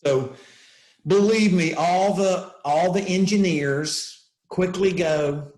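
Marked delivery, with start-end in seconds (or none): none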